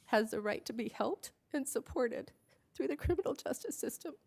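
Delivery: fighting back real tears mid-sentence; tearful. Real, not acted. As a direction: voice choking up, dropping to a whisper at times. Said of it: "I don't know why I'm emotional".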